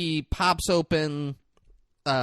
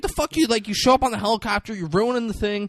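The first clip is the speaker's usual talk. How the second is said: high, quick retold outrage; hammed-up accusing rant; mock indignant; 6 semitones higher, faster than usual. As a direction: imitate an angry confrontation, pitched up. Retold confrontation with helicopter intruder.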